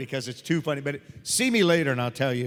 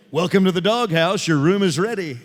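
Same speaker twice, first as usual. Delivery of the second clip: loud, booming mock welcome; big projected comic greeting; hotel greeter; 2 semitones higher, 8 dB louder than usual. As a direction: announce it like a hammed-up hotel host. Acts out doghouse hotel greeting.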